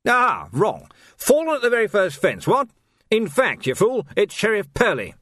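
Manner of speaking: put-on blustering old officer, high and loud; pompous captain. A narrator's reading. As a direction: crow like a smug, pompous officer catching someone out.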